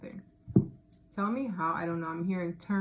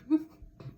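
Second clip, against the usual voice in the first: high burst of laughter; 9 semitones higher than usual. Real, not acted. Laughing at the chaos card.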